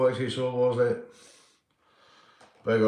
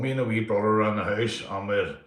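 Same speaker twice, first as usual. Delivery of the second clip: ordinary talk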